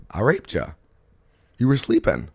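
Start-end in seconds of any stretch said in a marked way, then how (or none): 0.1-0.7 lightly imitated Southern twang; Southern US accent. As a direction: say it like a Southerner, understated. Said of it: "in a Southern voice".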